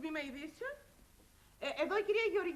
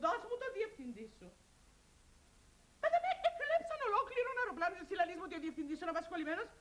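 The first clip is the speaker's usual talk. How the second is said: usual speaking voice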